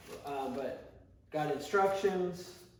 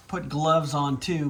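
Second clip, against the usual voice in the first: usual speaking voice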